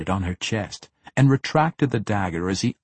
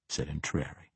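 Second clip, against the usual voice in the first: plain reading voice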